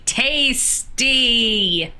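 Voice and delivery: TASTY stretched long on both syllables; drawn-out relish. Acted. Player repeating "tasty" at the game table, drawn-out relish.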